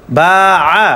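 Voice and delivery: বা held long, আ cut short; sustained বা followed by a quick আ; long then short vowel. Shown as a demonstration. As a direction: stretch বা, then give আ briefly with no drawl. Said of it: "আ কোনো টান হবে না".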